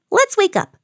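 very high bird voice; eager storybook birdie; chirping little bird. A narrator's reading. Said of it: "chirped Little Bird".

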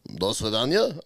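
low, mock Russian accent. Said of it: "That sounded pretty good".

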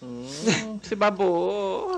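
slow, teasing drawl; mock sensual drawl. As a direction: draw it out like the sexy video. Mocking the ASMR clip.

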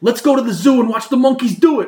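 high, loud goofy Jersey guy; mock Jersey guy suggesting the zoo; dumb guy voice. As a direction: pitch the idea like a bored basement buddy. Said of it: "they're just like".

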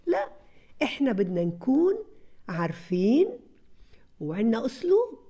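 ordinary talk, nothing marked

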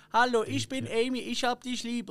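high-pitched robot voice reading out words. Retold or imitated. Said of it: "dann übersetzt eine Roboterstimme". (hohe Roboterstimme)

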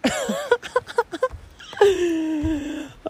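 a high laugh breaking toward tears; laughing so hard it nearly turns to crying; high tearful laugh. Real, not acted. Alone by the beach, a high tearful laugh.

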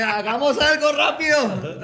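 high, loud, drawn-out shout; imitating a bystander calling for help; mock urgent call. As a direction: holler it out while amused. Mimics urgent call to the room.

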